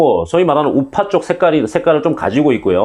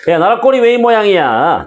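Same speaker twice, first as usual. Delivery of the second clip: exasperated shout; 7 semitones higher than usual. Pretend. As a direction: yell it like a fed-up citizen. Title shouted again.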